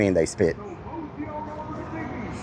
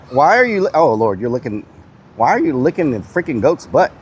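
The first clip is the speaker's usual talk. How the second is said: ordinary talk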